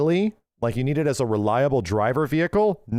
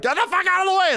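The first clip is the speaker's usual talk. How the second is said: much higher mock yell at traffic; high-pitched shout out the window; retold yell; 3 dB louder, faster than usual. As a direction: yell at the cars to move, hammed-up. Yelling at freeway traffic.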